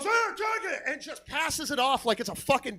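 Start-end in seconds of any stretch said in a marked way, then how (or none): 0.0-0.8 high, soft strained squawk; mock frantic hand-off in a high voice; panicked woman. Woman running from a trailer with an alligator, high frantic voice.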